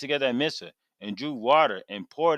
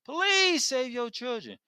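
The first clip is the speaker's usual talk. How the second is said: much higher, slow anguished plea to the Father; prophet crying out; 13 semitones higher than usual. Acted. Samuel cries out to the Father for Israel, high anguished plea. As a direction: cry out to God for your people. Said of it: "He like".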